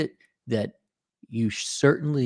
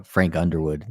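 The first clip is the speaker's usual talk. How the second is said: usual speaking voice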